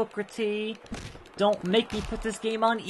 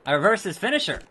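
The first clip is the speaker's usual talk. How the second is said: normal talking voice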